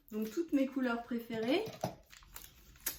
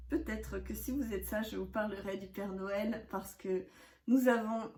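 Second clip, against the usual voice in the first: ordinary talk, nothing marked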